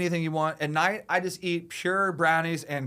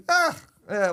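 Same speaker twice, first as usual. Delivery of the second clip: high, loud groan of mock exasperation; exasperated groan; 13 semitones higher, 6 dB louder than usual. Answering people who ask about the girl, exasperated groan. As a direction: let out a put-upon "ugh". Acting his reaction to nagging friends.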